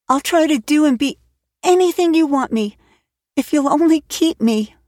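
promising anything to be kept; meek girl. Acted. Anne promising Marilla to behave, meek and high. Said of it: "returning meekly to her ottoman".

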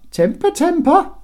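very high, loud sing-out; teasing. A narrator's reading. Guards mocking Bob's thrown axe, teasing. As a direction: taunt him from above. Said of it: "discombobulated by the teasing".

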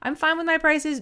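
high-pitched, rushed fretting; fear voice. Acted. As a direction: squeal anxious reassurances like an inner fear. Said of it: "that fear voice going".

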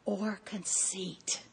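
breathy whisper, slowed right down. Acted. Preacher reading scripture to women, slow whisper. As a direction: let it fade to a whisper.

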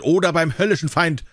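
high, angry oath; roared threat. A narrator's reading. Tyrant threatens the hesitating dwarf, angry high roar. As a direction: threaten him hard, pitch held high. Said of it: "brüllte, setzt der Unhold".

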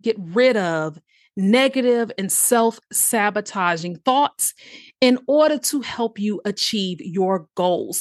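normal talking voice

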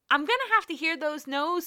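reliving her own pep talk with a bright push on "have"; fired-up self talk. Retold or imitated. To herself deciding to submit for the TED X talk, fired-up self talk. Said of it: "because you know what?".

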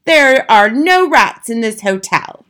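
slow, loud, firm denial. A narrator's reading. Manager insists to grandmother there are no rats, slow loud denial. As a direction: hammer each word out indignantly. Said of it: "cried Mister Stringer".

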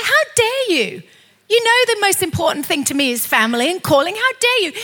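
high-pitched, righteous challenge; indignant rebuke. Acted. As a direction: stand up to an attacker, pitch leaping. Acted rebuke of the attacker.

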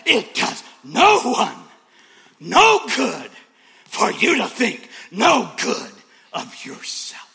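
big slow preaching shout, high and loud; booming pulpit delivery; preached loud and slow. Shown as a demonstration. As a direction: proclaim each word, stretching it out.

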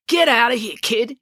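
mild yell to chase someone off; restrained shout. A narrator's reading. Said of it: "yelled Bruce".

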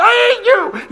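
sudden high, loud shriek breaking the calm; hammed-up laughing scream of frustration; mock outburst. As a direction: snap from fake calm into a laughing yell. Mock happy thoughts snapping.

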